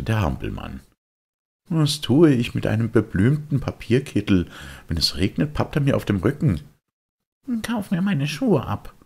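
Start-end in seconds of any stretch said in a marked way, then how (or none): 1.7-6.6 put-on high, know-it-all kid; a cocky boy brushing him off; smug boyish voice; the boy's voice. Boy scoffs at offer. 7.6-8.9 put-on high, longing puppet boy; a boy pulled toward the music; wistful high boyish voice; Bengele's voice. Bengele offers shoes.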